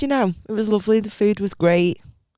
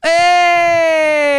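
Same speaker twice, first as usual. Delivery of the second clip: excited cheer; 9 semitones higher, 5 dB louder than usual. Real, not acted. Cheering news of a sponsor.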